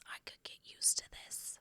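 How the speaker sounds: hushed unvoiced whisper. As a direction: whisper it like a guilty secret. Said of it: "A part of you goes".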